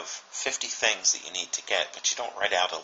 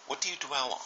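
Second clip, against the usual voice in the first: usual speaking voice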